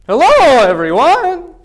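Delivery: loud, high, sing-out greeting; exaggerated high pitch. Shown as a demonstration. Lecturer demonstrating pitch to students, high and loud. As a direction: send the voice way up and loud. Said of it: "What was I doing with my voice there?".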